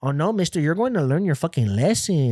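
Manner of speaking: high, sing-song scolding caricature. Retold or imitated. Woman cop lecturing a teen, mock scolding. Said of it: "they're, like, spicy Latinas".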